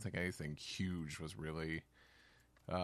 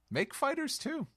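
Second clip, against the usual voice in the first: high mock voice; 4 dB louder than usual. Echoes the request in a raised voice.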